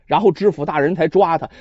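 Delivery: ordinary delivery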